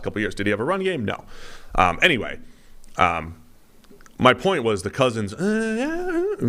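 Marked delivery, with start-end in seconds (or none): 5.3-6.5 high, wavering hemming noise; noncommittal noises. Acted. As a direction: hum and haw way up high. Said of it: "the noises that describe him".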